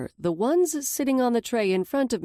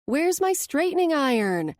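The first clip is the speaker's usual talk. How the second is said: calling out; 9 semitones higher, 3 dB louder than usual. A narrator's reading. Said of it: "Liv calls".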